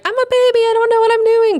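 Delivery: high, loud, helpless whine; mock baby wail. Mock wail of a clueless newcomer.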